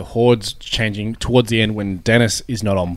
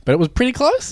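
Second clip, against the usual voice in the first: hopeful magician; 13 semitones higher than usual. Retold or imitated. Mock magician pleading.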